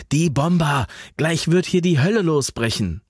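high, agitated warning; urgent alarm. A narrator's reading. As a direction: warn of imminent danger. Warning of bombers.

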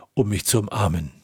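usual speaking voice

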